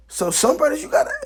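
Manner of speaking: sudden very high, loud preacher's cry; a soaring sermon shout; preaching cry. Acted. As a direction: lift it up to the rafters. Preaching peak.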